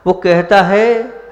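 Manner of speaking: slow it down and lift it to build suspense; emphatic build-up. Acted. Preacher builds to a point. ("वो कहता है" ऊँचे, धीमे, ज़ोरदार स्वर में)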